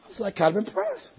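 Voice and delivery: ordinary delivery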